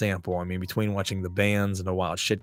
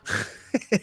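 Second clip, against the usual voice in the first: put-on tiny high-pitched, innocent child, very drawn out; cutest little kid's voice; 12 semitones higher, 5 dB quieter, much slower than usual. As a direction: plead slowly in a sweet little kid's voice. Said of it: "in the cutest little kid's voice".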